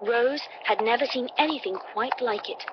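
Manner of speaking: usual speaking voice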